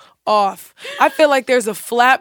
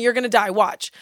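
ordinary delivery

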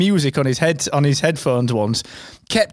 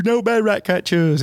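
slow, higher put-on catchphrase; imitating Don; 5 semitones higher, slower than usual. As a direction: draw out the catchphrase in his odd voice. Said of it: "He was, he was".

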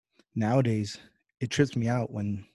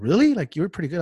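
surprised friends; 7 semitones higher, 5 dB louder, faster than usual. Retold or imitated. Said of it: "I was actually telling my friends".

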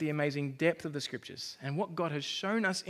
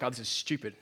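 muttered disdain, low at first, then building; mock grumbling; 6 semitones lower, 4 dB louder, faster than usual. Preacher shows what grumbling to God is like, mock grumbling. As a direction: grumble as if you know better. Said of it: "But, but grumbling is like".

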